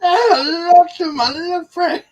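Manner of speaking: put-on high, loud, hammy Cuban accent; mock Scarface Cuban accent. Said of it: "the worst Cuban accent ever put to film".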